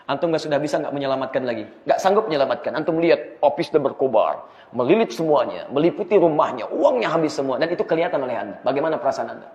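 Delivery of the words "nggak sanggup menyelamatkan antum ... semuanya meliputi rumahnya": ordinary talk, nothing marked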